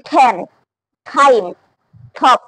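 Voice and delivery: ঠ্যান, ঠাইম, ঠপ each opening on an aspirated t. Shown as a demonstration. Student reciting rule examples, aspirated t in ঠ্যান, ঠাইম, ঠপ. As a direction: puff air after the t in ঠ্যান, ঠাইম, ঠপ. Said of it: "এখন থেকে এসব জায়গায় ঠ".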